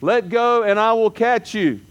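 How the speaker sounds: lightly voiced Lord, high and slow at first; a hint of a booming heavenly voice. God answers the dangling man from heaven, solemn and measured. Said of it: "he hears a voice out of heaven".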